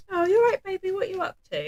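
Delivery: put-on high cooing voice to a toddler; sing-song baby talk. Mum coos to her toddler, high and sing-song. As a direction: coo it like talking to a little one. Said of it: "I'll say to her".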